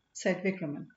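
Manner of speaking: usual speaking voice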